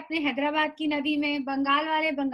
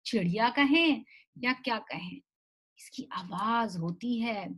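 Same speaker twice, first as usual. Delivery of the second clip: ordinary talk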